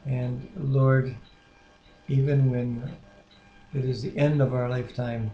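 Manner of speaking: soft, reverent spoken prayer. Acted. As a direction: lead the prayer gently and sincerely. Husband leads prayer.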